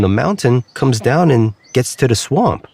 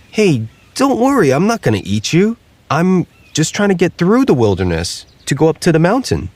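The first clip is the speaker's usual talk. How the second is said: higher, calming a scared turkey; reassuring alligator; 8 semitones higher than usual. Acted. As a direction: soothe the bird, pitched up.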